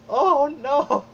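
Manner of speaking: watching everybody vanish, horrified. Retold or imitated. A fan recalls the snap, high horrified oh no. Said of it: "I'm like".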